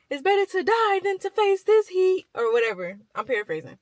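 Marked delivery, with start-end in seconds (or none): 0.1-2.3 mock wail, much higher and louder; dramatic despair. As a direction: ham up Jonah's misery, pitched high. Jonah despairing in the heat.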